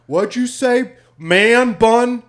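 mock the guy's man bun with a high jeer; mocking taunt. Heckler dad mocking, retold.

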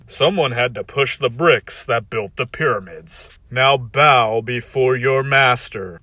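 slow, cold tyrant's gloat; cold rasping evil emperor. Acted. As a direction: savor it like a cruel emperor. Tyrant demands bowing.

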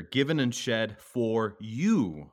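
"given and shed for you" drawn out slowly, each word weighted; slow stressed reading. Shown as a demonstration. Pastor stressing the promise to the congregation, slow weighted reading. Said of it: "which is another emphasis".

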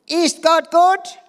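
hoch und laut geschrien, langsam bei „Ist Gott gut?“; zorniger Schrei. Acted. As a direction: den wütenden König herausschreien. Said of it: "schrie der König". (der König schreit zornig seinen Diener an)

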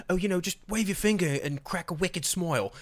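offhand film director impression; imitated director. Voicing a director instructing an actor.

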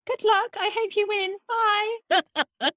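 put-on high, sweet, lightly posh child's goodbye; a young princess wishing the team luck; posh little princess. Host imitates Charlotte wishing the Lionesses luck, high and posh. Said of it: "she sounds so posh".

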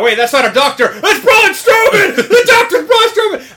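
high, loud shocked cry of discovery; imitating a stunned fan yelling the twist; shocked realization. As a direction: shout it like you just caught the trick. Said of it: "half that audience is thinking".

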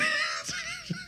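breathless, cracking high laugh; high squealing laugh. Real, not acted. Dad cracking up at his crying son, squeaky laugh. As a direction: let a squeaky laugh burst out.

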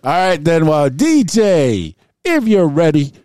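loud, drawn-out hype call; hype announcer. Real, not acted. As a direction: call for the beat like a host. Hyping up the DJ.

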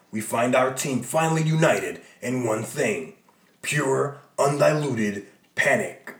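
loud, low announcer voice; dramatic narrator. Acted. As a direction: boom it like a trailer. Reads dramatic caption boxes.

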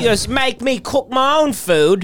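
hammed-up Australian yob complaining about Korean barbecue; Australian bloke. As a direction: whinge about the restaurant service like an Aussie. Mock rant as the Australian man.

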